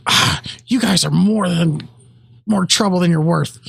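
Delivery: lightly voiced exasperated game character; a hint of a fed-up villain; Fizzworth. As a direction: sigh into it, a touch weary. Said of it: "in the tone, in the diction of, of Fizzworth".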